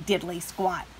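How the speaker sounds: ordinary delivery, nothing marked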